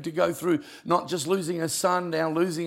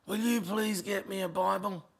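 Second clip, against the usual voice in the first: weak plea; 3 semitones higher, 6 dB quieter than usual. Retold or imitated. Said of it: "Can hardly talk".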